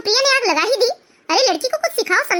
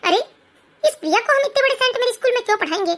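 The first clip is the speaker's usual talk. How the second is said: ordinary talk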